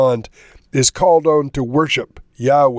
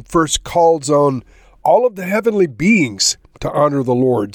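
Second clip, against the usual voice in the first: normal talking voice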